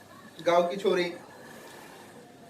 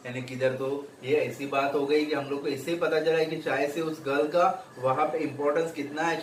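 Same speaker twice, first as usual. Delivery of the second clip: ordinary delivery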